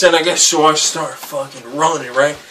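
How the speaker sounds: ordinary delivery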